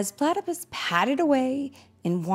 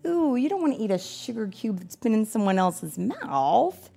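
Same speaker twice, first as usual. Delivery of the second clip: ordinary delivery, nothing marked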